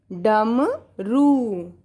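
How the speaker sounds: very slow drawn-out डमरू; dictation word. Shown as a demonstration. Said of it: "क्या लिखेंगे हम?".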